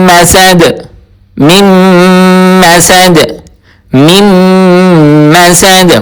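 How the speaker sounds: teacher presenting the word; word demo. Shown as a demonstration. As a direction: say مسد clearly, one word at a time. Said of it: "وہ ہے".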